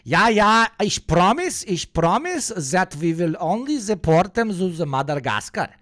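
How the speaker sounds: high-pitched mock German accent, ranting; bad German-accented Hitler. Streamer mimicking Hitler in a mock interview, bad German accent. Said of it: "your English German accent is horrible".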